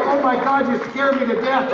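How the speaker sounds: startled. Retold or imitated. Partner startled by the arrest.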